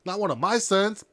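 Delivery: loud, high-pitched outraged retort; indignant dad. Retold or imitated. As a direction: act out a father flaring up in disbelief. Outraged dad in retold story.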